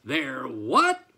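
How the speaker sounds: loud, with "what" shooting upward; disbelieving outburst, rising at the end; incredulous cry. A narrator's reading. Farmer answering the horse's news, loud incredulous cry. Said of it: "cried Farmer McPhee".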